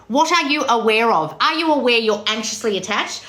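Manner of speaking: normal talking voice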